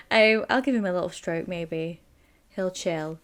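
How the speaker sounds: normal talking voice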